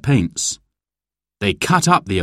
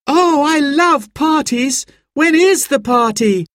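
put-on very high, thrilled little-girl voice; excited child; 3 dB louder than usual. A narrator's reading. Girl hears about the party, bursting with excitement. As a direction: squeal the words with delight. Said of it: "jumping up and down with excitement".

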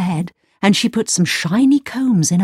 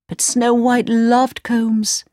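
ordinary delivery, nothing marked

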